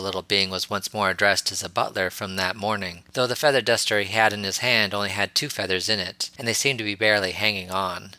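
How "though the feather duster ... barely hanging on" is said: ordinary delivery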